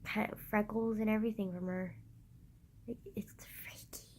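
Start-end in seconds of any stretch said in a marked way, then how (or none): 2.9-4.1 hushed, barely voiced aside; eerie whisper. Telling viewers of the uncanny resemblance, eerie whisper. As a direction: whisper it as if spooked.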